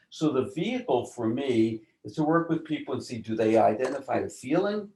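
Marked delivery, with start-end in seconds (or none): none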